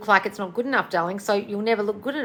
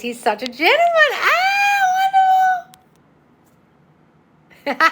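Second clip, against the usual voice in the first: much higher and louder, squealing with joy; delighted gushing; 8 dB louder than usual. Real, not acted. Host delights in a viewer's new boyfriend, squealing high. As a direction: gush over the viewer's great news. Reacting to good news from a viewer.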